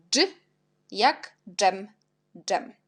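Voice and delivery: normal talking voice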